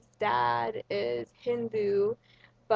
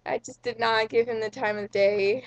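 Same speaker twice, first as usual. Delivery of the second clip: normal talking voice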